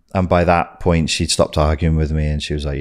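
ordinary talk, nothing marked

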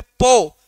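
"पो" punched out on its own; stressed syllable. Shown as a demonstration. Teacher isolating poetry's first syllable, "पो" stressed hard. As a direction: hit a long, strong "पो". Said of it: "ये आपका stressed आ रहा है".

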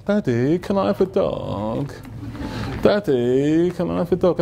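slow, drawn-out wheedling; mimicked daughter sweetly begging; sweet little girl. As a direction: melt Dad with a stretched, pleading question. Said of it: "your sweet little girl".

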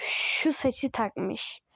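slow drawn-out words. Real, not acted. Describing the avatar's hair, slow and drawn out. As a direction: savour each word slowly.

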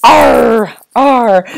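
playful teacher's pirate arr. Shown as a demonstration. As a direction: ham up a cheerful pirate growl for the kids. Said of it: "I always think I sound like a pirate".

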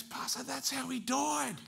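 very high, drawn-out excited cry; astonished exclamation. Retold or imitated. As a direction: cry out slowly in high-pitched amazement. Ma's astonished exclamation, retold.

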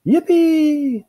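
loud, high-pitched squeal; delighted squeal. Acted. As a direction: squeal with glee, long and high. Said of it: "squealed Missus Pickle".

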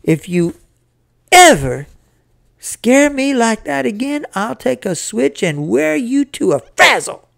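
high scolding with an explosive EVER; angry shouted threat. A narrator's reading. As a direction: threaten the boy like a furious mother. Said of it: "she shouted".

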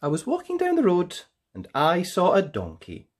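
ordinary delivery

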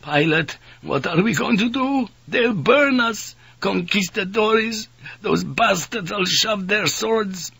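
high, frightened wail of dread; trembling voice. A narrator's reading. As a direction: voice climbing high as fear takes over. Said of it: "Maitsuka's voice began to tremble".

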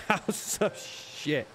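breathy, slow, laughing through House of Shit; can barely get the words out for laughing. Real, not acted. Repeats the joke title to viewers, laughing.

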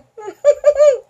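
high giggling burst; burst of giggles. Real, not acted. Reader giggling at a funny picture, high burst of giggles.

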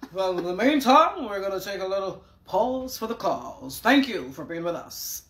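smooth on-air announcer voice; radio announcer voice. Retold or imitated. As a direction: mimic his radio presenter delivery. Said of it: "You hear that voice?".

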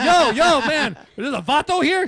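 loud, high panicky bystander voice; mock panic. Bystanders freaking out when the DJ yells Vato, loud mock panic. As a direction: play scared onlookers ducking, loud.

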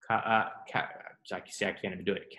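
ordinary talk

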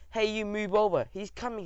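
high-pitched, lightly voiced shout from the crowd. A narrator's reading. Audience member telling someone to make way, shouting. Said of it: "he shouted".

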